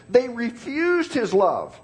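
"refused" hit, said slowly; stress on refused. Shown as a demonstration. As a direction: make them catch "refused". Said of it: "catch this".

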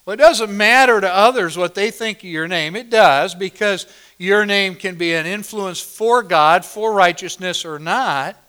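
loud, ringing pulpit delivery; thundering sermon voice; full-force preaching. Acted. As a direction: proclaim it to the whole congregation.

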